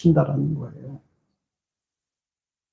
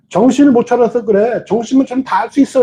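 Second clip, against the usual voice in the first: higher, nagging scold; scolding saying; 6 semitones higher than usual. Retold or imitated. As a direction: scold like people usually say it. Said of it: "이렇게 말하고 있잖아요".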